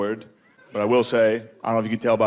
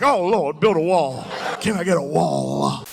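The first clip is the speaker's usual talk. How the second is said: mock-devout church outcry; Holy Spirit fervor; 3 semitones higher, slower than usual. Dad moved by the news as in church, mock fervor. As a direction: plead like a worshipper moved by the Spirit. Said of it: "gets hit with the Holy Spirit".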